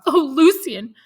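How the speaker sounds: high, loud cry of alarm, calling his name; anxious cry. Acted. Veiled lady crying out to her lover in alarm. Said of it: "she cried out".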